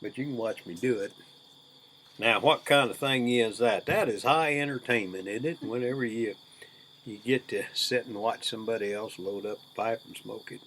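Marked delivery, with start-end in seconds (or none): none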